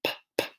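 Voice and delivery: P, p, soft breathy pops; P, p sounds, softly. Shown as a demonstration. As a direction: puff out the p sound. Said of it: "P makes the p sound".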